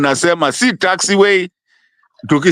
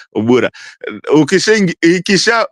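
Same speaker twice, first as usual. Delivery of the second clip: normal talking voice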